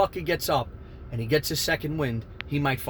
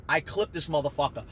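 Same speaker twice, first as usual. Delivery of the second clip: ordinary delivery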